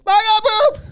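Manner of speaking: put-on high, loud toddler cry; kid needing toilet. Child five seconds after sitting down, loud high kid voice. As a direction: blurt it as a desperate small child. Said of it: "five seconds".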